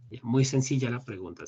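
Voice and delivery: ordinary talk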